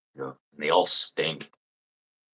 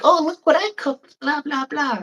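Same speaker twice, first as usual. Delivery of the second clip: very high squeaky mock voice; mocking Facebook poster; 4 dB louder than usual. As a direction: squeal it like a smug home cook showing off. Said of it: "It's like".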